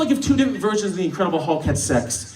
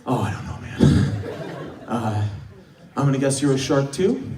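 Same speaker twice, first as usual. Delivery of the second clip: a soft, unsure mumble, lower; hesitant, quiet guess; 8 semitones lower, 5 dB quieter than usual. Retold or imitated. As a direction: guess nervously under your breath. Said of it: "And I'm like".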